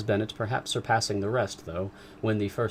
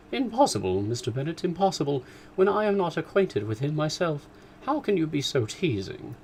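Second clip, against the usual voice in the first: high, shrill-free emphatic protest; mother refusing the idea; mother protesting to her husband; 7 semitones higher than usual. Acted. Mrs Bennet protests.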